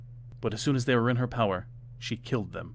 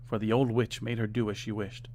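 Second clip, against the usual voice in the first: plain reading voice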